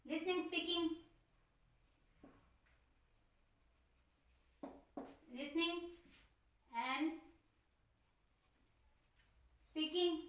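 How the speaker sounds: each word spaced out slowly and softly while writing; slow dictation. Shown as a demonstration. Teacher dictating notes to the class, slow and soft. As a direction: dictate it word by word for students to copy. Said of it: "देखो, emphasis on".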